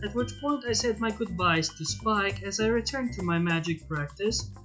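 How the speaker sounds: usual speaking voice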